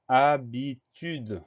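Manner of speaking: HABITUDE slow and split, a-bi-tude; syllable by syllable. Shown as a demonstration. Teacher introducing the next dictation word, syllable by syllable. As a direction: sound out HABITUDE in separate, drawn-out syllables. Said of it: "il y a quatre syllabes".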